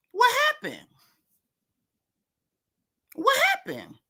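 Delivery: high squeaky mocking "what happened". Vlogger mocking Candace's silence, high squeaky put-on voice. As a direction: squeak it out like a clueless innocent.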